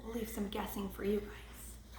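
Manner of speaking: soft whisper. Real, not acted.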